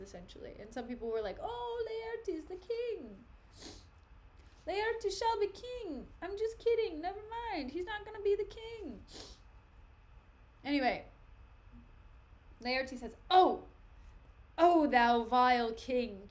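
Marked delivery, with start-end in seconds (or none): 1.4-9.0 high, put-on fawning voice; hammed-up high-pitched townsfolk; mock crowd. Mock crowd hailing Laertes. 13.3-16.3 loud, declaimed accusation; theatrical angry outburst; acted outrage. Laertes confronts the king.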